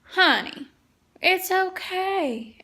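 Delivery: a hint of the husband soothing her; reassuring husband. Husband comforts her, retold.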